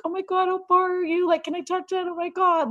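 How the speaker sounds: high, breathless gushing voice; gushing over a stranger's bump. Retold or imitated. Imitating an excited stranger cooing over a pregnant woman. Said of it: "those annoying people that would be like".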